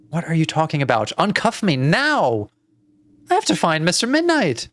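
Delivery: high, urgent demand, stretched at the peak; frantic child, voice climbing; desperate girl. Acted. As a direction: demand to be freed at once. Plays the girl demanding release.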